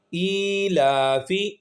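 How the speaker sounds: ایلافی with ای and لا held long, فی short; very slow stretch. Shown as a demonstration. Teacher drawing out the long vowels for students. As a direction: stretch ای and لا, clip فی. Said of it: "ایک ایک الف کے برابر کھینچیں گے".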